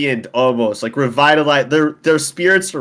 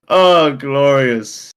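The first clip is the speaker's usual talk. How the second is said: put-on slow, dazed drunken murmur; drunken sigh; much slower than usual. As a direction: drift off happily drunk. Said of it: "It's like".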